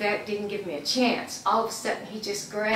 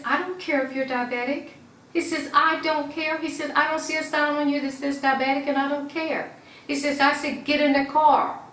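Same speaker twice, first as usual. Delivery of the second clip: raised, higher voice imitating an officer's dismissal; retold angry officer; 6 semitones higher, 3 dB louder than usual. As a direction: quote the officer insistently, pitch lifted.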